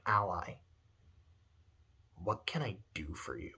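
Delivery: soft, low, caring voice; hushed, tender concern; genuine empathy. Acted. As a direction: speak gently, as to a troubled friend. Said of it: "She shows genuine empathy".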